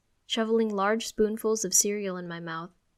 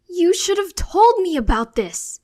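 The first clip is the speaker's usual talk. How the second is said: much higher and louder, furious; angry reply; 10 semitones higher, 13 dB louder than usual. Acted. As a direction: lash out at her. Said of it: "I angrily reply".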